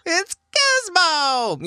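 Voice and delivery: high screechy gnome, hugely drawn out; slow, piercing high holler; loud gnome voice. Retold or imitated. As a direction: screech the introduction like an excited gnome. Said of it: "like, a loud gnome voice".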